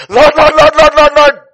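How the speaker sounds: high, shouted rapid repetition; Zechariah's urgent cry. Acted. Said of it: "you know what Zechariah says?".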